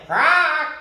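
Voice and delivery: very high, loud squawk; parrot squawk. Acted. Parrot squawking to rouse his friends, high and loud. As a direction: squawk sharply.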